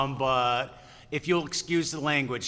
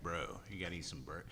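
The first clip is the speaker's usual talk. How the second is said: low, hushed, quicker aside; low character voice; 9 semitones lower, 16 dB quieter, faster than usual. Retold or imitated. Character to Mia about burgers, low hushed voice. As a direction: start it low and under your breath. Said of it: "I'm just like".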